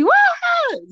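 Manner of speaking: very high, slow mock yell; high drawn-out mock shriek; wordless fan yell. Shown as a demonstration. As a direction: show the empty fan scream. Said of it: "Gritar, gritar, así".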